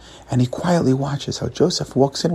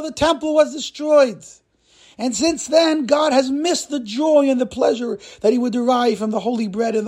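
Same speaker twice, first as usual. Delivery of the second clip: impassioned holy rabbi; 9 semitones higher than usual. Acted. Holy rabbi explaining what God has missed, impassioned lament. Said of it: "looks at him and says".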